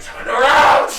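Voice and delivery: loud high yell to turn back; urgent shout. Acted. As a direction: bellow the order in alarm. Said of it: "It's like".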